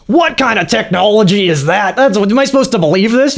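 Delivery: mock disbelief, voice lifted high; incredulous complaint. Quotes critic's incredulous complaint about movie.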